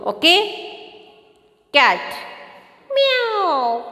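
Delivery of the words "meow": high, drawn-out falling meow; cat meow imitation. Shown as a demonstration. Teacher shows children how a cat sounds, high imitated meow. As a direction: mimic a cat with a long high mew. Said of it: "sounds I will show you".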